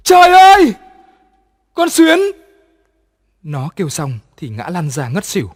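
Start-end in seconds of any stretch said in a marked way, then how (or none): 0.0-2.2 shrieking high and loud in terror; terrified scream. A narrator's reading. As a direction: scream at the sight of a ghost. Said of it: "kêu thét lên".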